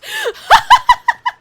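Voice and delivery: high, loud burst of laughter; shrieking laugh. Real, not acted. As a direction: laugh out in a squeal. Laughing at chat.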